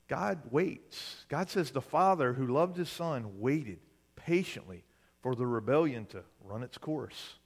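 normal talking voice

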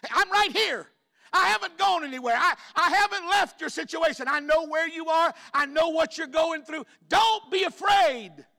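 sustained high, very loud pulpit cry; preacher's shout. Acted. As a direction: shout God's assurance at full force. Preacher voices God at climax.

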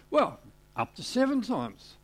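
cheeky offer. Acted. Peter bargaining cheekily.

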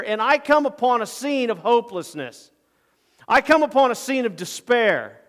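loud, high indignant rebuke; angry. Acted. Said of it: "He was angry".

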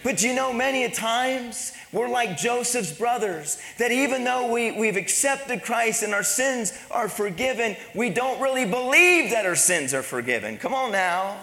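impassioned preaching. Real, not acted. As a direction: press the story home to the congregation. Preaches on joy at full force.

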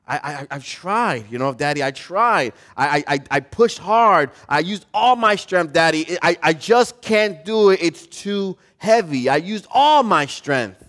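lightly acted child's frustrated plea; protesting little boy. Little boy telling Daddy he tried, frustrated protest. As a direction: stammer it out, rising in pitch and insistence. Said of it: "And the little boy says".

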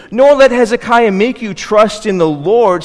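high, loud and slow, each word hammered; loud slow proclamation. Acted. Spokesman warning the people not to trust the Lord, loud and slow. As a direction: proclaim it slowly and loudly to the crowd. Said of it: "cried out in the loud voice".

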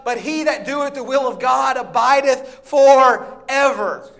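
loud, high, slowing pulpit reading of the verses; scripture reading. Acted. Preacher reading First John to church, booming scripture reading. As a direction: declaim the King James text.

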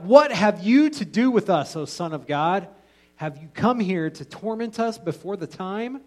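loud, high cry of the possessed men; demons crying out. A narrator's reading. Reading the demons' cry to Jesus, loud and high. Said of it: "they cried out".

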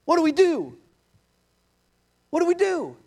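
high, loud cry for help; panicked plea. Acted. Acted plea to brother for help.

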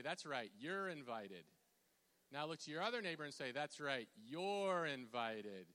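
"you're invited" loud, with "you're" stretched right out. Shown as a demonstration. Cueing congregation to repeat louder.

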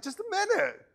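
a hammed-up lazy officer enjoying his donut; lazy cop. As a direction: brush off the victim with mock nonchalance. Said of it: "You're like".

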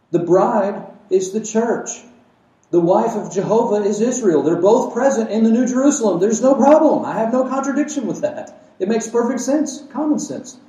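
rising pulpit fervor; preaching at full force. Acted. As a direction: build the sermon to its height.

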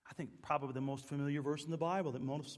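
ordinary delivery

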